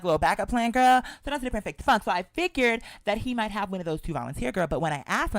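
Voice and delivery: ordinary talk, nothing marked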